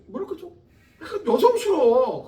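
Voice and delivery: ordinary talk, nothing marked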